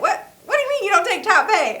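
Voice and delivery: high, incredulous what do you mean; mock outrage at the cashier, pitch jumping up; shocked disbelief. To the Walmart cashier, disbelieving shock. Retold shock at store checkout.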